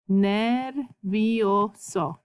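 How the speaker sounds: Nervioso stretched syllable by syllable, ner-vi-o-so; sounded out slowly. Shown as a demonstration. Teacher sounds out the word syllable by syllable. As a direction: sound out every vowel of Nervioso. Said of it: "if you sound out words".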